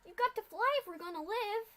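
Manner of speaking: high, loud desperate plea; pleading yell. Acted. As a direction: beg her to move, now. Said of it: "Scootaloo pleaded".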